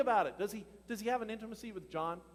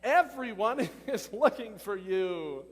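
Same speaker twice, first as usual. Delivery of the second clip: exclaiming disciples; 8 dB louder, much slower than usual. Acted. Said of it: "they exclaimed".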